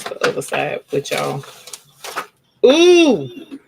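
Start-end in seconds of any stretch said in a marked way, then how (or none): 2.6-3.6 high, loud delighted squeal; delighted ooh. Real, not acted. Sees the finished pressed scarf.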